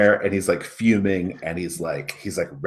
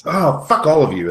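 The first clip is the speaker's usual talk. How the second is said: loud and higher, embarrassed snapping; flustered angry blurt; 9 semitones higher, 8 dB louder than usual. Acted. Student caught blurting his crush then lashing out at the group, loud and flustered. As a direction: blurt the name then snap at everyone.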